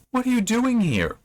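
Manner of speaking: high-pitched imitation of shocked challenge; startled demand. Hut owner finding escaped prisoners at his door, startled high-pitched demand. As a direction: start very high, as if catching intruders.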